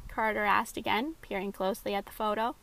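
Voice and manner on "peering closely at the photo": ordinary delivery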